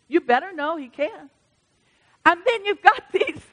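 normal talking voice

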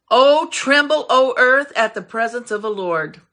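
loud scripture command, easing at the end; commanding proclamation. Real, not acted. Preacher reads David's command to the earth, forceful proclamation. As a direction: hit the opening words hard.

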